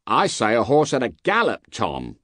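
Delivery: guard voice. Acted. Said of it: "returned the guard".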